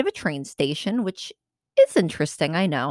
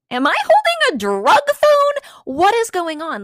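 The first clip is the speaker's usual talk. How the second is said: very high, loud mock panic; panicked disbelief; 13 semitones higher, 5 dB louder than usual. Handed a stranger's phone after his threats, mock-panicked disbelief. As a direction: shoot way up high in hammed-up disbelief.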